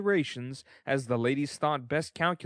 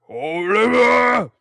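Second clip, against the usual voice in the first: slow, booming call, much louder and higher; impressive call; 9 semitones higher, 14 dB louder, much slower than usual. A narrator's reading. Beadle calling through the keyhole, slow booming impressive call. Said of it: "said in a deep and impressive tone".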